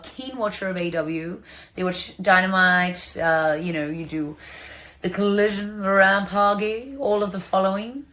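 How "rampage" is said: Rampage drawn out as ram-PAH-gee; playful mispronunciation. Shown as a demonstration. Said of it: "that's the way I like to say it".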